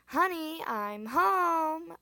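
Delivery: high, loud, singsong honey I'm home; playful sitcom greeting. A narrator's reading. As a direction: call it out like a cheesy sitcom spouse. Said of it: "I laughed".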